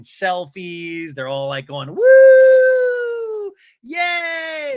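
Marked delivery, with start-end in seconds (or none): 1.9-4.8 high, loud whooping; mock cheering. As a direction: whoop like an excited tourist. Mimics women cheering on the bus.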